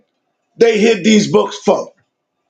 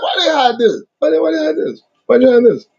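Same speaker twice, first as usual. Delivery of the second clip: disbelief; 5 semitones higher, faster than usual. Real, not acted. Indignant at the hidden text.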